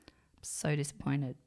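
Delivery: hushed, voiceless disappointment; dad's crushed whisper; disappointed whisper. Retold or imitated. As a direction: breathe it out like a let-down dad. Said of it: "And it was in that tone".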